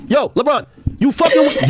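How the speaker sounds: normal talking voice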